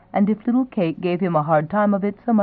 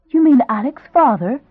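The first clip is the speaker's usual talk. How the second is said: higher, louder shocked question; gasping surprise; 7 semitones higher, 6 dB louder than usual. A narrator's reading. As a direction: gasp it out, stunned. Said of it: "Elizabeth gasped".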